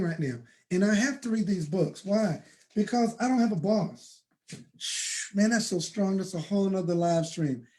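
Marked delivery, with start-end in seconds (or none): 4.8-5.3 long voiceless hushing exhale; drawn-out shh. Real, not acted. Speaker reacting to his own strong point, hushed shh. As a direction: let out a soft, hissed shh.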